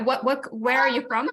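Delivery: ordinary talk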